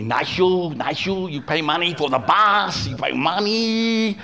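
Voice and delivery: put-on high, slow Vietnamese-accented mechanic. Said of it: "a Vietnamese mechanic".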